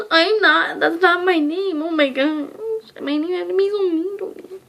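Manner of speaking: loud, high-pitched retold wail; mock crying outburst. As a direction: wail it out in exaggerated upset. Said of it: "I'm, like, gonna cry".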